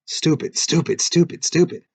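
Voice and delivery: sharp, high self-scolding; inner yell. A narrator's reading. Narrator voicing the boy's inner voice yelling at himself. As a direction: yell at yourself inside your head. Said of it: "his internal voice yelling".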